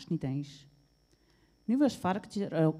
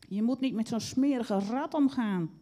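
high, louder scolding; angry; 5 semitones higher, 5 dB louder than usual. A narrator's reading. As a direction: scold a friend crossly. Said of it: "zei ze boos". (boos bestraffend)

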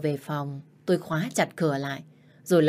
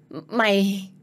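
sputtering rage; 3 semitones higher, slower than usual. Acted. Stepmother caught out, furious.

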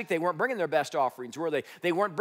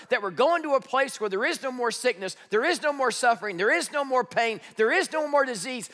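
preaching at full force; 6 semitones higher than usual. Real, not acted. Building preaching list.

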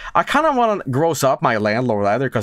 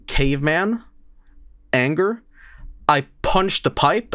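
ordinary talk, nothing marked

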